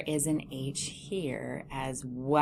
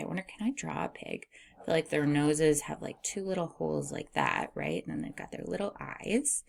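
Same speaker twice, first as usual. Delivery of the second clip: usual speaking voice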